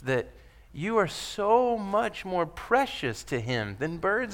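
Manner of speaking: preacher to his church, tender; tender reassurance. Acted. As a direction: comfort them softly. Tender voicing of Jesus.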